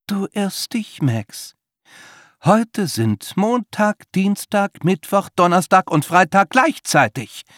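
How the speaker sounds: high, slow, smug and triumphant; Winston's voice. A narrator's reading. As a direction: announce nonsense with total conviction. Narrator voices Winston character.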